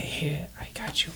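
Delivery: whispered aside. Acted. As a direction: breathe it so the dealer can't hear. Slipping coins quietly to gnome.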